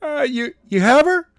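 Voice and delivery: put-on a teen boy pushing for an answer; Skylar asking. A narrator's reading. Skylar on the phone, pressing Daniels. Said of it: "asked Skylar".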